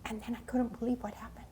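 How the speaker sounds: quick breathless whisper; hushed whisper. Retold or imitated. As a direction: whisper it like a secret about to spill. Suspense before story climax.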